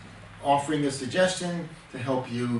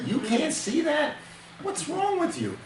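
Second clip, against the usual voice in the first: slow, much higher incredulous jab; expressive contempt. Shown as a demonstration. Husband mocks wife's dress, exaggerated contempt. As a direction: push the scorn to a shrill peak. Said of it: "many will say that more expressively than others".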